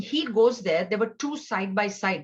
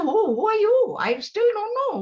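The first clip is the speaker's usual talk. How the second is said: much higher and louder, mock bewildered; scornful puzzlement; 13 semitones higher, 6 dB louder than usual. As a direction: brush him off with fake confusion. [name] keeps brushing Arjun off.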